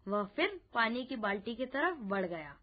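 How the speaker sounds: usual speaking voice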